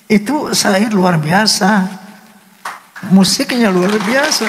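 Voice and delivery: high, slow voice choked with wonder; tearful awe. Real, not acted. Composer overwhelmed by his own song's lyrics, tearful awe. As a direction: draw the praise out through tears. Moved to tears by his song.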